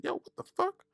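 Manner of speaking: very high-pitched mock outrage; defensive. Host retelling getting defensive with her, high and offended. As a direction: yelp it defensively without raising volume. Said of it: "I got defensive real quick".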